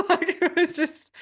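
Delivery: real laughter bubbling through the words, high and loud; laughing. Real, not acted. Coach retelling a spat with her partner, laughing. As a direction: crack up while trying to keep talking. Cracks up at own story.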